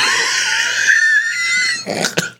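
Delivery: high squealing laugh; cracking up. Real, not acted. Host cracks up mid-story about needing the bathroom, squealing laugh.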